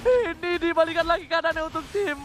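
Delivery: loud, drawn-out esports call; hyped play-by-play. Acted. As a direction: call the fight with rising hype. Hype casting of teamfight.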